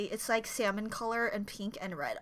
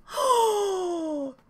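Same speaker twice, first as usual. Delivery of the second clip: high, drawn-out falling gasp; 9 semitones higher, 5 dB louder than usual. Real, not acted. Fan dreading the favorite part, high gasp. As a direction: gasp in awe, high and long. Reacting to an upcoming song part.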